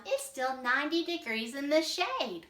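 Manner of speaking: can't believe it's winter already; surprised alligator. Acted. Read as Alvaro's line.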